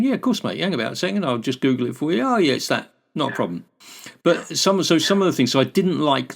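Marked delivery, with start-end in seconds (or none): 0.0-3.6 cheery Kiwi helper. Pretend. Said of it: "how would I have responded to that back home in New Zealand".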